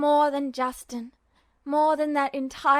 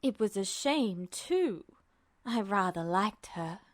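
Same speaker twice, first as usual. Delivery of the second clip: a lightly lowered, detached godlike voice; cold, mocking Truth; 5 semitones lower, 5 dB quieter than usual. A narrator's reading. Truth mocking Ed, cold and low. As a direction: voice the entity, chilly and amused.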